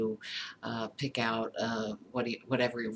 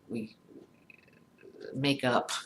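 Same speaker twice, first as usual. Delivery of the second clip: ordinary delivery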